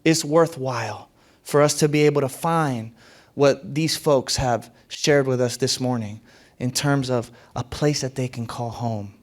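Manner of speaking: ordinary delivery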